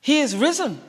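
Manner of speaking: high, loud announcement with a falling end; triumphant proclamation. Acted. Angel proclaims the resurrection in story.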